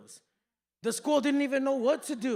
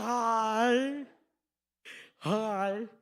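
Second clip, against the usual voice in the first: drawn-out mock headgear greeting; exact mocking copy; 3 dB louder than usual. Customer mimicking the cashier, mock headgear voice. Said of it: "do the exact same".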